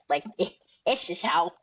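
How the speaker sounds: normal talking voice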